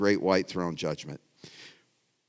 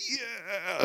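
cheering yeah; 9 semitones higher, 8 dB quieter than usual. Retold or imitated. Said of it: "I'm like".

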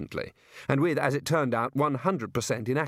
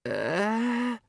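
nervous; 10 semitones higher than usual. A narrator's reading. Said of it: "said Ponder nervously".

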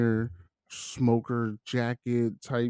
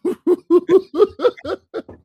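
loud laugh; 8 dB louder than usual. Real, not acted. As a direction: burst of high, loud laughter. Laughs at friend's line.

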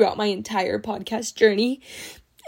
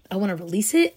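ordinary talk

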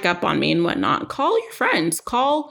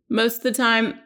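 usual speaking voice

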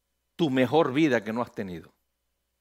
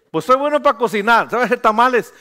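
higher, louder put-on voice of a church member; eager congregant; 8 semitones higher, 8 dB louder than usual. Member telling the pastor he can cook, loud eager put-on voice. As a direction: play the member answering eagerly.

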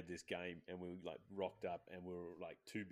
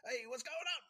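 much higher put-on voice of a sneaky little dude; sneaky guy voice. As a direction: squeak it like a little sneak hiding behind cars. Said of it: "Just like this voice".

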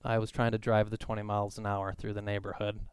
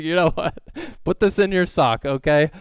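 put-on mom to kid; 5 semitones higher, 8 dB louder than usual. Mom talking to daughter, louder.

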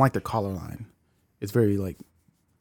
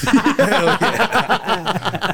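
laughing; 7 semitones higher than usual. Real, not acted. Laughing at own slogan.

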